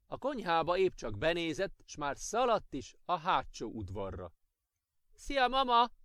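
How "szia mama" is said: loud, high call across the yard; little girl calling loudly for grandma. A narrator's reading. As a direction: shout a greeting to grandma. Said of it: "Kiáltotta".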